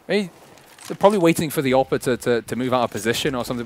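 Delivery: normal talking voice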